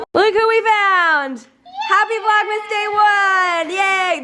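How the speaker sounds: high, slow, excited cheer. Real, not acted. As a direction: cheer them in with joy. Greeting visitors, cheering.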